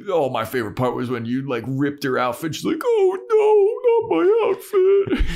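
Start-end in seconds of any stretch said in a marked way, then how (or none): 0.1-2.5 higher, quick jeering imitation; mocking roast. Vampires roasting Buffy in their lair, mocking. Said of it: "vampires are just roasting Buffy". 2.8-5.3 very high, loud fake sobbing; mock wailing. Buffy reacting to her ripped outfit, mock wailing.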